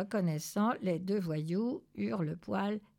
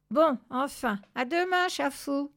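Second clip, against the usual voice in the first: lightly voiced higher goodbye; light farewell; 6 semitones higher, 4 dB louder than usual. A narrator's reading. Family friend leaves the cat, lightly calling goodbye. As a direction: sign off in a raised voice.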